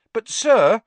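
high, loud protest. A narrator's reading. Ron objecting to Snape's order, protesting. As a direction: burst out in objection.

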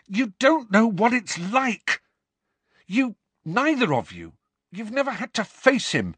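angry outburst. Acted. Harry's angry outburst at friends.

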